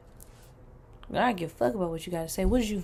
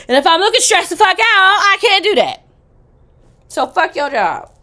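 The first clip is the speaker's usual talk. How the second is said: much higher and louder, shouting; loud excited rant; 11 semitones higher, 13 dB louder than usual. Real, not acted. Shouting that a stressed look won't do for Big Daddy. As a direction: blow up about the job.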